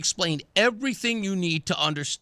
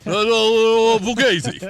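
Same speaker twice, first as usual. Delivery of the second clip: loud, higher mush-mouthed gibberish; garbled Rocky impression; 7 semitones higher, 8 dB louder than usual. Host mimicking himself for Mark, unintelligible mock-Rocky mumble. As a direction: slur it all until only the last word is clear. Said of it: "nobody has any idea what he's saying".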